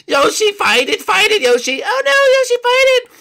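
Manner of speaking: very high loud pleas; desperate shouting. Acted. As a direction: scream at him to fight it. Pleading with hypnotised Yoshi.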